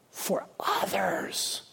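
slow, forceful stage whisper; hushed emphasis. Real, not acted. Preacher urging prayer on behalf of others, intense whisper. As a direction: drop to a drawn-out whisper for weight.

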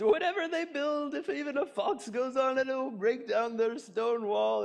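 high, strained voice cracking up at his own joke; laughing hard. Acted. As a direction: barely get the words out through mock laughter. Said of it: "laughing so hard he could hardly talk".

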